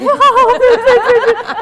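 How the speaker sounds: loud, high mock laughing fit; imitated laughing outburst. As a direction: burst out as if cracking up mid-take. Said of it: "he's laughing". (నవ్వును అనుకరిస్తూ గట్టిగా)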